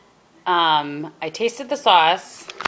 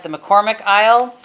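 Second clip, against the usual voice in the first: ordinary talk, nothing marked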